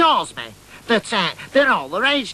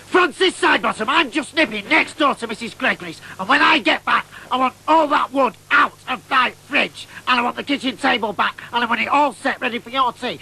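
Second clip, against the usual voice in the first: stern telling off; 4 semitones higher than usual. Retold or imitated. Mother scolding her son by full name before going out. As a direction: lay down the law.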